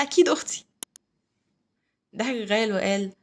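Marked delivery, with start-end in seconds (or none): 0.0-0.6 higher, louder eager reply; eager agreement. A narrator's reading. Brother promising his sister, eager and emphatic. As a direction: agree at once, lifting the voice.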